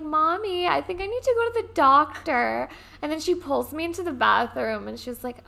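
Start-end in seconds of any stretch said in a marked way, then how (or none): 0.0-2.7 put-on higher, louder little-girl plea to mom; childlike plea. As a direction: play the scared kid asking mommy. Child voiced to mom in story.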